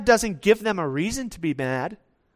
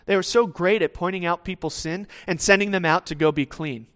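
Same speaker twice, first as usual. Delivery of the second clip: ordinary talk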